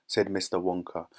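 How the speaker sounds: ordinary delivery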